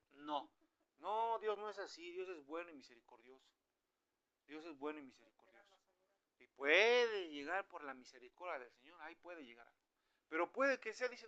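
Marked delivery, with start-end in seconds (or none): none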